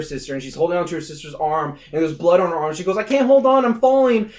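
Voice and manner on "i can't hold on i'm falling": high, loud plea; hammed-up cry of someone slipping; mock panic. Sister holding on over the balcony, mock panic.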